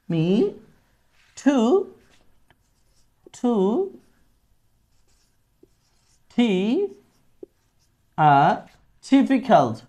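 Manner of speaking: word by word with long pauses; slow, halting drill. Shown as a demonstration. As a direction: stumble through it, piece by piece. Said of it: "আমি সবগুলো একটু বাংলা লিখে দিচ্ছি". (থেমে থেমে ধীরে উচ্চারণ)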